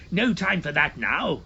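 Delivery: loud, impatient; shouted. A narrator's reading. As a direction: cut him off, hurry him. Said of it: "shouted his uncle".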